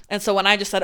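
ordinary talk, nothing marked

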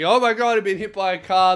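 loud, high imitation of a victim; mock screaming panic. Comedian as girl hit by a car, yelling in mock panic. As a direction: cry out in hammed-up alarm. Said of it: "everyone's yelling and screaming".

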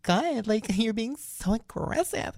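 high, slow, mock-offended whine; offended stranger. A hooker scolding the narrator for pushing her, high and slow. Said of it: "And she was just like".

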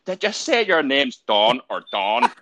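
put-on high, loud, slow Northern Irish accent; old Northern Irish lorry driver. Mimicking a lorry driver asking a name, Northern Irish accent. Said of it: "super old Northern Irish dude".

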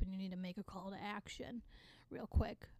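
normal talking voice

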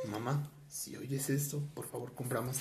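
soft whispered plea to mom. Pretend. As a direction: whisper it like a secret message. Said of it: "unvoiced whisper". (susurro suplicante a mamá)

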